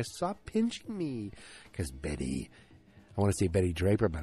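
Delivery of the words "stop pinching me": put-on very high, slow little girl plea; child's plea. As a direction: whine it like a small kid, high and drawn out. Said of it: "Then you hear".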